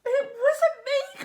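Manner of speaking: high, slow wailing protest; crying out. A narrator's reading. Pete denying blame to the captain, high wail. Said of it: "cried Pete".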